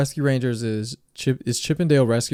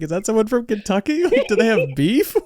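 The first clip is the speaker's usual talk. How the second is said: confused bystander; 8 semitones higher than usual. Retold or imitated. Imagined overhearer, high.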